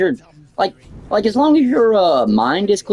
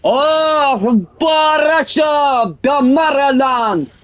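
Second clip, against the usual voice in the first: Russian imitation; 12 semitones higher, much slower than usual. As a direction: belt it out as a hammed-up Russian. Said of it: "The imitation of Russian".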